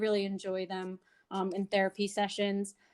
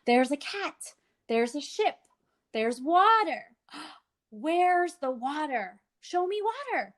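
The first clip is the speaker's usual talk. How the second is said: higher, slow labelling then a gasp; animated comments to child; 5 semitones higher, slower than usual. Shown as a demonstration. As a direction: label things warmly, then ask. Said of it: "make three comments and then ask".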